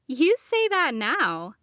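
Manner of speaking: slow, sly, knowing tease; teasing. A narrator's reading. Talia teases Grant about liking him, sly. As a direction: needle him playfully. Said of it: "Talia teased".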